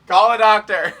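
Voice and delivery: loud high mock alarm; mock panic. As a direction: yell it in fake panic. Mock alarm over sample.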